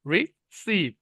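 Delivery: Receive split, ri then stressed SEEV; stress on second syllable. Shown as a demonstration. Teacher showing where stress goes, receive stressed on SEEV. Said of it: "এখানে স্ট্রেসটা যাবে".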